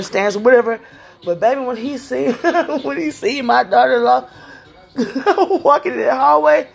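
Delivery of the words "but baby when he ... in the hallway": high, giggling through the words; can't stop laughing at the memory. Real, not acted. Telling a friend about the weed man, laughing.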